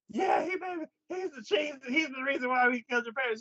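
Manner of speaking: high, cracking fake sobbing; hammed-up sobbing woman; mock crying woman. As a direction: wail it in a put-on crying voice.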